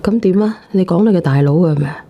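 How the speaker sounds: ordinary talk, nothing marked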